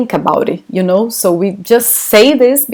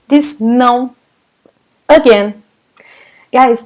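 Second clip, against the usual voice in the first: ordinary talk, nothing marked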